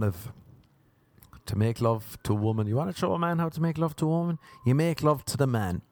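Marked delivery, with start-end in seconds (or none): none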